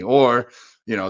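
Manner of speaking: ordinary talk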